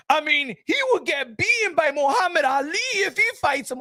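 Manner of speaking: ordinary talk, nothing marked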